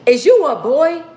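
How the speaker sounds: put-on high, loud little-boy question; mock nosy kid voice, loud and high. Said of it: "one little boy, he was like".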